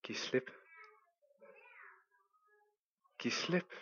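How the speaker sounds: very soft, hushed voice; barely above a breath; quiet voice. Acted. God's voice tells the climber to let go, very soft. The voice answers once more.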